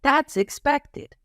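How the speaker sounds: high, slow, sing-song "that's expected"; cheerful high approval. Shown as a demonstration. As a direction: chime it in like a cartoon helper.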